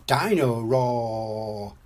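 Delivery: slow, drawn-out "Roar"; excited toddler. A narrator's reading. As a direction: relish the toy's name, mildly excited. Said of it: "says George excitedly".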